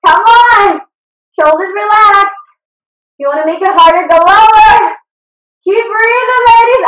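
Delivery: fired-up trainer calling out cues; loud coaching. Real, not acted. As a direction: push the class hard through the set. Coach urging class through burn.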